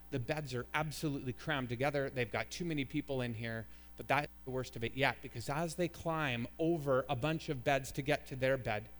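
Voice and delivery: normal talking voice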